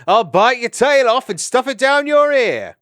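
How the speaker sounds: high, loud playful bellow; shouted threat. Acted. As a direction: roar it across the water. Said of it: "He shouted then".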